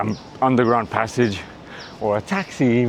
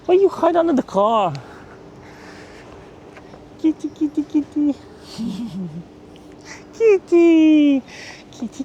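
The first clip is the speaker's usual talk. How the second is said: very high sing-song kitty call; cat-calling voice. Real, not acted. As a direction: coax a cat out in a tiny high voice. Coaxing a stray cat.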